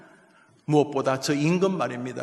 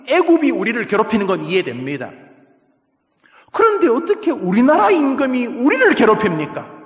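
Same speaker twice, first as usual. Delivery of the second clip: indignant protest; 8 semitones higher, 8 dB louder than usual. Acted. Preacher voicing prophet's complaint.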